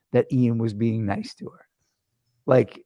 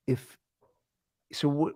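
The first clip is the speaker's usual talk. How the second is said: ordinary talk, nothing marked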